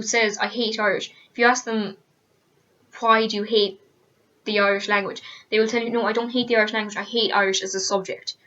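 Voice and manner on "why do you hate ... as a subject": usual speaking voice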